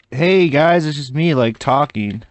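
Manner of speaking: high, loud cheerful greeting; perky vlogger voice. Pretend. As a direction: chirp it like a peppy YouTuber. Mock upbeat vlogger greeting.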